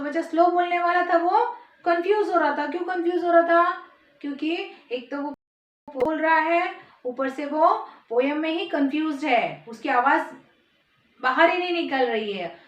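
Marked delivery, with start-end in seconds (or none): none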